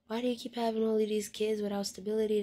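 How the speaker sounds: usual speaking voice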